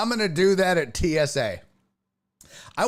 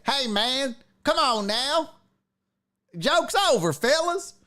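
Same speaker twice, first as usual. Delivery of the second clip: mock Alabama good-old-boy voice; Alabama accent; 10 semitones higher than usual. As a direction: put on an Alabama hillbilly accent, pitched way up. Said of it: "with an Alabama accent".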